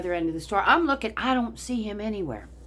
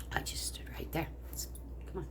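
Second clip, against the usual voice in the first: whisper; 3 semitones lower, 17 dB quieter, faster than usual. Real, not acted. Whispered beat.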